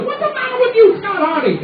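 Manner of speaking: imagined teacher yelling at Scott Hardy, shrill high scold; angry teacher. Retold or imitated. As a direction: shriek at the boy like the furious teacher. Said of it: "imagined teacher scolding".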